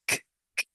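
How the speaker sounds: "K, k, k" as bare voiceless clicks; soft, isolated bursts for "K, k, k"; sound of K. Shown as a demonstration. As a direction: release "k" from the back of the mouth with no vowel. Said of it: "se lee así".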